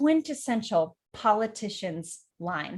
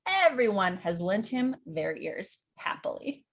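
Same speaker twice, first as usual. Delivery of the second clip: usual speaking voice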